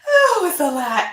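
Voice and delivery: tears of joy. Real, not acted. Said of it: "they are tears of joy".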